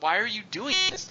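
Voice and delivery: high-pitched protest, not truly shouted; mock yell. Fan calling to the owner on the sidelines, mock yell. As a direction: act out an exasperated yell at normal volume. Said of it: "I just started yelling".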